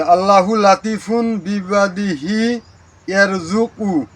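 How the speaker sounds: slow, deliberate reading of the verse, each syllable clear; slow careful recitation. Shown as a demonstration. Teacher reciting the verse slowly for viewers to copy. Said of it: "উচ্চারণটা খুব সুন্দরভাবে সঠিকভাবে করতে হবে".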